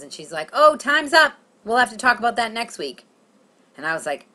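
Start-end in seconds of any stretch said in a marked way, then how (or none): 0.5-3.0 higher, louder professional cut-off; brisk therapist. Retold or imitated. Therapist ending the session, brisk. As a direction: close the session on a raised, breezy note. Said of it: "Did she say that normally".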